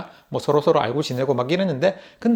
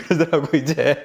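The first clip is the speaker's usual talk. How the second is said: laughing. Real, not acted. Laughing at the story.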